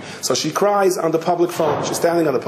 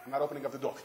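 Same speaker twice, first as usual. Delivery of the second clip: stubborn refusal; 15 dB quieter, much faster than usual. Retold or imitated. Husband behind door.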